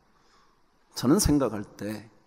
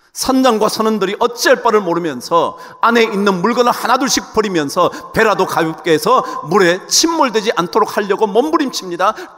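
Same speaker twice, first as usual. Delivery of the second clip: urgent, raised preacher's narration; high, driving narration; dramatic sermon storytelling; 8 semitones higher, 9 dB louder than usual. Acted. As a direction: tell the storm with pressing force.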